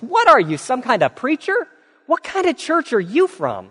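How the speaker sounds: high, incredulous asking; surprised question. Retold or imitated. Bartender to the pastor after the prayer, incredulous. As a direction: ask in disbelief, voice jumping up.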